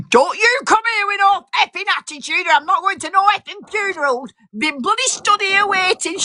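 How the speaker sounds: high, mock furious rant; sister-in-law ranting. Sister-in-law telling her off for being late, mock angry. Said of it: "and she went".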